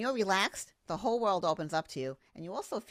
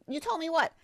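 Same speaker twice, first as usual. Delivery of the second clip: confused surprise; 10 semitones higher, 3 dB louder than usual. Retold or imitated.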